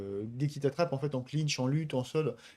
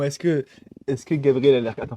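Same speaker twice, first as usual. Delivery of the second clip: ordinary talk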